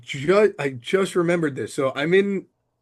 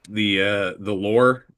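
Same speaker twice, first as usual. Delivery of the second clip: ordinary talk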